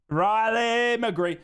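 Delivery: RILEY stretched out long; drawn-out name cheer. Real, not acted. Streamer savours his favourite player's name, drawn-out cheer. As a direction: draw out Riley McGree slowly, savouring it.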